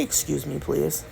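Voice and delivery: soft, gentle "Excuse me, please"; polite excuse me. Shown as a demonstration. Telling viewers what to say in the store, softly. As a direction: ask kindly and quietly.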